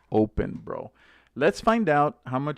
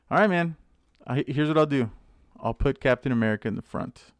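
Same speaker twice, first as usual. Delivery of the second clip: normal talking voice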